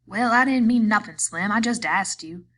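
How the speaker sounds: high, loud defensive whine; loud protest. A narrator's reading. Curly protesting loudly to Slim. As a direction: push back hard, nearly shouting.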